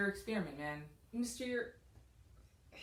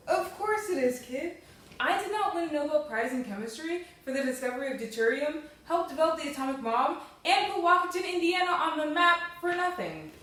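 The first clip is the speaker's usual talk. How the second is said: loud, puffed-up bragging; indignant boast; 5 semitones higher, 9 dB louder than usual. Acted. Advisor boasting to his student, loud and indignant. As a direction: trumpet your credentials, offended.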